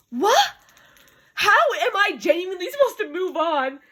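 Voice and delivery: high-pitched, wailing disbelief; overwhelmed disbelief. Real, not acted. Reader undone by the hero's promise, high wail. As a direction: cry it out high, incredulous.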